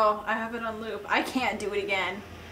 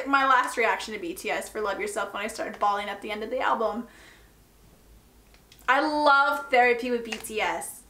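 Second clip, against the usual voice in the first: ordinary delivery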